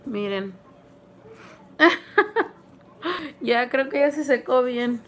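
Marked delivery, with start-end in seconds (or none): 1.8-2.5 high squealing laugh through pain. Real, not acted. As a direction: laugh while it hurts. Mask pulling at skin.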